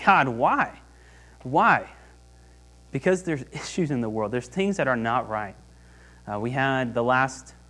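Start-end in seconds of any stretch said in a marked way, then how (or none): 0.0-1.9 raised, pleading why; a lightly acted lament to heaven; crying out to God. David crying out to God, raised pleading. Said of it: "He's just crying out, saying".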